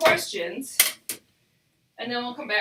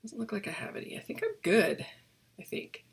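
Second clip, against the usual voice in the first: whispered under her breath; whispering; 6 dB quieter than usual. Real, not acted. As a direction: whisper to yourself while checking. Muttering to herself while checking.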